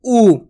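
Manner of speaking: ऊ drawn out as a long 'oo'. Shown as a demonstration. Teacher showing the long oo, drawn out. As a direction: hold ऊ as a long 'ooo'. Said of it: "थोड़ा खींच को होता है".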